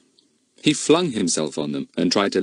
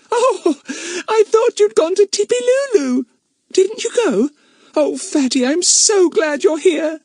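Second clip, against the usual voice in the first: high, breathless and relieved; overjoyed; 5 dB louder than usual. A narrator's reading. Bets hugging Fatty, high and overjoyed. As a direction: gush with relief.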